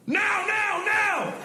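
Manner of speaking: very high, acted urgent shout; frantic high-pitched mock command; military guys shouting. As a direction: yell the landing signal like a crewman. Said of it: "these two big military guys get up and go".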